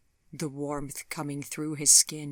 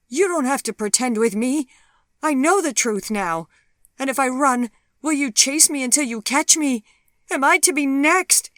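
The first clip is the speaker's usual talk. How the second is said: high, loud, frantic accusation; a terrified woman confronts the man she fears; quavering fear; 11 semitones higher, 12 dB louder than usual. Acted. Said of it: "her voice quavering".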